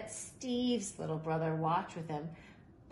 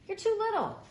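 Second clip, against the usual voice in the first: dismissive; 4 dB louder than usual. Acted. Boys leave Steve behind.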